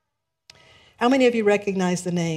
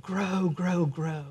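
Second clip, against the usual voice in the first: soft grow; 5 dB quieter, much slower than usual. Retold or imitated. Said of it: "always saying".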